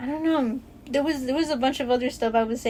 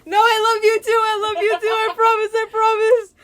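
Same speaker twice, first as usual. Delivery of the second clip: high, loud laughing protest; pleading through laughter; laughing reassurance; 9 semitones higher, 7 dB louder than usual. Real, not acted. Assuring her friend she still loves her, laughing high.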